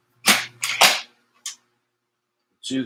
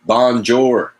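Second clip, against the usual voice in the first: louder showy French Bonjour; French accent; 4 semitones higher, 6 dB louder than usual. Shown as a demonstration. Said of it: "See, listen to this".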